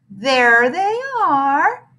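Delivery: slow, high coo. Acted. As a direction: draw it out sweetly, slow. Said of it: "cooed a voice from the doorway".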